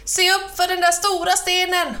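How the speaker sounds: high-pitched alarmed call; shouted warning. A narrator's reading. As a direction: cry out a warning. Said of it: "ropade Kråkan".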